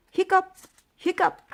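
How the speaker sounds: high squeaky hiccup noises; monster hiccups. Acted. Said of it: "the monster had hiccups".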